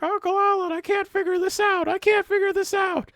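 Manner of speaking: put-on high kid voice begging his uncle for help; whiny little kid. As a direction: beg like a frustrated toddler. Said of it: "And then I was like".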